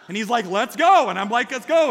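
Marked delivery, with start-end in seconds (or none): 0.5-1.1 eager shout. Retold or imitated. Brothers set off down slope. 1.5-1.9 eager shout. Retold or imitated. Speaker answers brother, excited.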